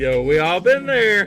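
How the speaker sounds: loud, high hyped agreement; loud shout. Real, not acted. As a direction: yell it out. Reacting loudly to the video.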